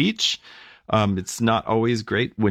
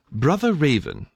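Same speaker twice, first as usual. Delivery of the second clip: put-on raised proper English accent; 6 semitones higher than usual. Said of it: "he had a proper English accent".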